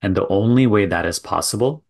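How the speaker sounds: measured guiding delivery with long silences between phrases; contemplative spiritual teaching. Real, not acted. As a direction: let each phrase land, then leave a long pause. Leads a contemplative talk.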